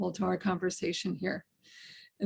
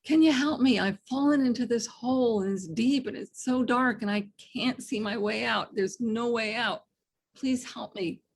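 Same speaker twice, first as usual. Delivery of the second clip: pleading yell; 3 semitones higher than usual. Retold or imitated. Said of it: "the guy yells up".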